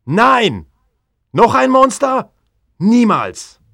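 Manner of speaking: high, loud outraged shout; furious refusal. Acted. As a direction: hurl the refusal at him. Viktor refusing the monster.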